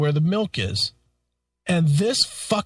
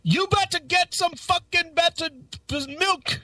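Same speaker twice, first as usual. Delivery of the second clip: high, mocking Indian accent for the shop owner; ranting shop owner in a put-on Indian accent; 12 semitones higher than usual. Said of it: "he's got that horrible accent".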